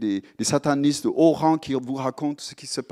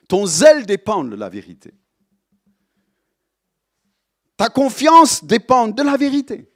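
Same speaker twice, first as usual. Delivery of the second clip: rising, louder repeated refrain; preaching build; 8 semitones higher, 8 dB louder than usual. Acted. Preacher hammers the congregation about truth, building louder. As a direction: drive the truth home harder each time.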